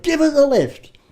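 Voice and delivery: high call for a lift; calling to the last truck for a lift; shouted plea. Retold or imitated. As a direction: shout up at the pickup, pitch up. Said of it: "and I shouted".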